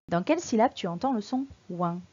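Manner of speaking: usual speaking voice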